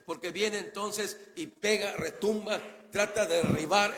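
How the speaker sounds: rising into a loud preaching climax; building preaching. Real, not acted. Preacher stirring the congregation about God's voice, building.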